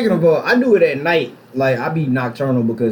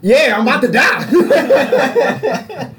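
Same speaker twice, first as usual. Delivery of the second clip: laughing; 8 semitones higher, much slower than usual. Real, not acted. Joking about college as Dark Souls.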